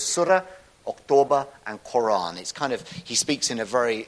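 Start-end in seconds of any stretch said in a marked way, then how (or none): 0.0-1.5 "Surah" and "October" pitched higher and sounded out like the preacher; mimicking his words. Pointing out words to catch in the video, mimicking the preacher's way of saying them. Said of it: "listen for the words".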